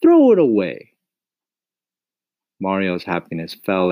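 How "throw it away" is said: high, louder firm command; mother telling boy to throw it away, louder; stern order. Acted. Mama orders.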